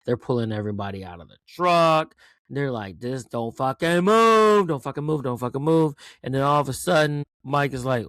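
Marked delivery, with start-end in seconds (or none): 3.0-5.9 shouted order. Retold or imitated. Soldiers halting the group at the truck, shouted order. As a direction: bellow it like an armed order.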